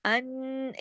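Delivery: अन- held as a long nasal n; steady humming अन-; big ghunnah. Shown as a demonstration. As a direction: sustain the n of अन- in the nose. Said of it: "कितना बड़ा गुन्ना हो रहा है".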